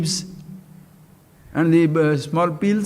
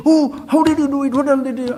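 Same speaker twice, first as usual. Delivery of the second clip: fast, raised and breathless with excitement; excited king; 7 semitones higher, much faster than usual. Retold or imitated. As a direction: rattle it off like a thrilled king. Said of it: "And the king is very excited".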